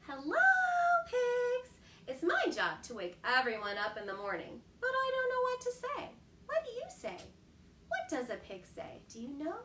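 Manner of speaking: light, high little rooster voice; a small worried chick asking for help; baby rooster. A narrator's reading. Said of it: "said Baby Rooster".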